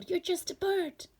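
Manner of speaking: mocking friends. Friends mocking the small bird.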